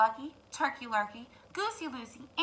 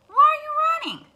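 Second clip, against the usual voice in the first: high, fast, loud rooster voice; hurried rooster; 10 dB louder, much faster than usual. Acted. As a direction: rattle it off like a startled rooster. Rooster asks, quick.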